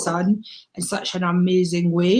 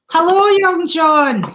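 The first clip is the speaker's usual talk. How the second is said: bright greeting; 8 semitones higher, 6 dB louder than usual. Real, not acted. Warmly calling guest onto show.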